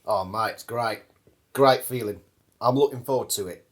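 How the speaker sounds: Aussie bloke, low and chuffed; Australian accent. Acted. Competitor tells the interviewer it's great, in a light Australian accent. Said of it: "Australian Bruce going into the grand final".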